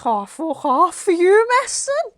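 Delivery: loud, slow, high-pitched disbelief; incredulous scoff. Retold or imitated. Client scoffing at phone healer's toning, loud drawn-out disbelief. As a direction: drag it out high, utterly incredulous.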